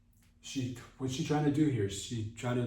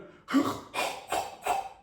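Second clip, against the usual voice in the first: harsh breathy gasp; exaggerated puffs of breath; mock heavy gasping. Mock straining during accented phone call.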